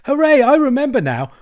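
loud, high cheer of delight. A narrator's reading. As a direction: cheer with gleeful excitement. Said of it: "cried the Mole delightedly".

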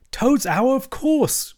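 high-pitched delighted cry. A narrator's reading. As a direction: burst out in glee, voice leaping up. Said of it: "cried the Mole delightedly".

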